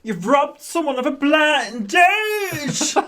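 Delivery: high, slow mock outrage. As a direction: wail the accusation, stretching the last word. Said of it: "Scylla's going".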